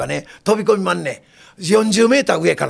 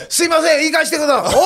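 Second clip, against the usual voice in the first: eager volunteer; 9 semitones higher than usual. Retold or imitated.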